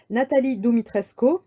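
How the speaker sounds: ordinary delivery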